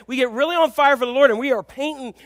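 ordinary talk

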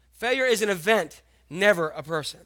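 ordinary delivery

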